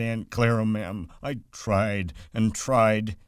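put-on a big simple man pleading like a boy; Lenny pleading. A narrator's reading. Lenny pleading with Aunt Clara.

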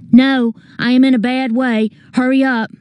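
high-pitched, urgent call; urgent distress. A narrator's reading. Calling up to rescuer in peril.

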